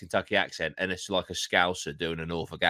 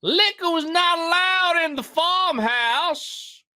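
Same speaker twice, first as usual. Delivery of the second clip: very high, slow prissy voice; prim falsetto; 7 dB louder, slower than usual. Shown as a demonstration. Reading the matriarch's rule in a high, slow mock voice. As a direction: Liquor pitched way up and drawn out slowly. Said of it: "uh, sorry".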